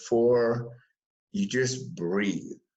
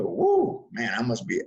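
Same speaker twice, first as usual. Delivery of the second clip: startled whoa. Retold or imitated. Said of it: "I'll just look down and go".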